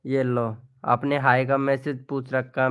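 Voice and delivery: ordinary talk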